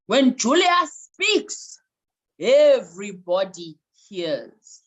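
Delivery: very slow, weighty voice of authority; Julius Malema. Acted. Coach channelling a public speaker's authority, very slow. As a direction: draw out each word like an orator. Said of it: "channel the energy of Julius Malema".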